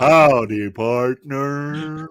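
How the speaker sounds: loud mock Texan accent on Howdy; loud mock Southern cowboy greeting; hammed-up cowboy. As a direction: put on a John Wayne style Southern accent.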